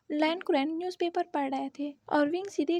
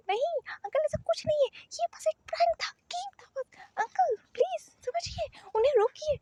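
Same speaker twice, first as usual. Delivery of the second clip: high desperate pleading; 10 semitones higher than usual. Acted. Wing begs uncle to intervene.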